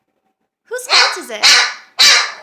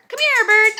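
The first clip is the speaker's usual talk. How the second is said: high, louder sing-song calling; coaxing a wild bird; 4 semitones higher, 5 dB louder, faster than usual. Real, not acted. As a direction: coax the bird over. Calling bird to come over.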